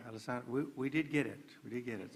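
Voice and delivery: normal talking voice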